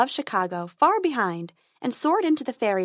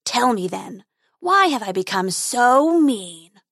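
growling dragon guarding treasure; 5 dB louder, slower than usual. Acted.

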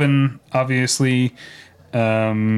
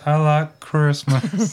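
slow drawn-out [name] impression; imitating [name]; 3 semitones higher, much slower than usual. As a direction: stretch it out like [name]. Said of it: "And then she says".

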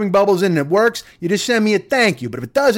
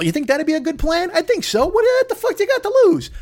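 mock Trump, building; 10 semitones higher than usual. Comic mocking the president urging injections, climbing giddy voice. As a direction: pitch climbs way up into a laughing yelp.

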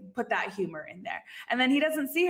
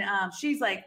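normal talking voice

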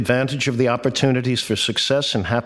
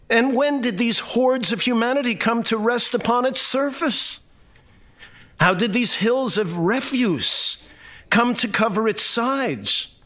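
rising alarm, higher and higher; startled disbelief; 8 semitones higher than usual. A narrator's reading. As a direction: pitch climbing high in bewildered questions. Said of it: "came to a startled halt".

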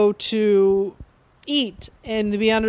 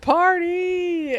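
higher cheer; mock party cheer; 8 semitones higher than usual. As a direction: toss off a cheer. Bender cheering.

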